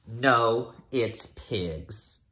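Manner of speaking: plain reading voice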